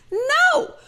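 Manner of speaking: high emphatic no; a sharp, high no, shutting it down; a high, firm no. Real, not acted. Answering her own question.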